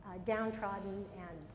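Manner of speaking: hushed therapist guiding a memory; guided visualization. Acted. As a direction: lead her gently into a bad memory.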